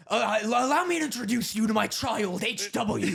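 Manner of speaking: lightly voiced 1920s oil baron; grand old-money introduction, mildly done; booming oil tycoon drawl. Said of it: "I look like a nineteen twenties oil baron".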